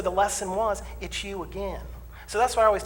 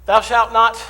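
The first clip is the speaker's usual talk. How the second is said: louder, lofty proclamation of a rule; booming commandment; 5 semitones higher, 11 dB louder, slower than usual. Acted. Preacher declaring a mock commandment, booming. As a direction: thunder it like scripture.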